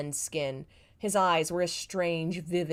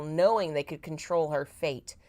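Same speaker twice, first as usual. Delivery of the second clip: ordinary delivery, nothing marked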